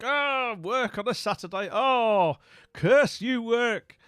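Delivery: high, loud theatrical lament; hammed-up cursing at a cruel fate; mock anguish. Streamer bemoaning work on a Saturday, loud mock despair.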